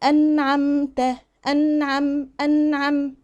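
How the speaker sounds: slow chanted "أنعمت", n and m held distinct; clear noon and meem. Shown as a demonstration. Teacher shows noon going with meem, slow chant. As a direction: let the n and m of "أنعم" ring evenly. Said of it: "النون ماشية مع الميم".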